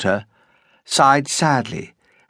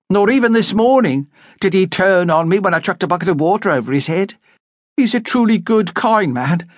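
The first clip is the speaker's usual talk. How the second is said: higher, earnest defence of her husband; lightly voiced young woman; 9 semitones higher than usual. A narrator's reading. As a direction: insist he's a good man.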